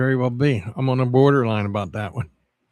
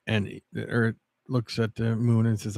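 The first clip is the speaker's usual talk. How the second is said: ordinary talk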